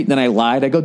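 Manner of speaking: usual speaking voice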